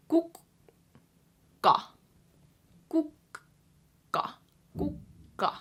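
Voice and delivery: kukka slowed down with the K held, one take whispered; kukka, repeated with a K hold; drawn-out kukka. Shown as a demonstration. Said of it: "you're ending with the K".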